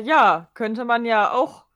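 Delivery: normal talking voice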